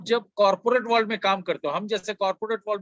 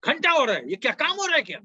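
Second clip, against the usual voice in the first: harsh scolding boss; 8 semitones higher, faster than usual. Shown as a demonstration. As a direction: snap at the team member like a bossy manager. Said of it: "कभी भी किसी को मत बोलो".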